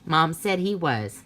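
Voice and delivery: ordinary delivery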